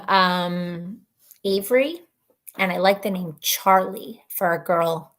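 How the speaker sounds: ordinary delivery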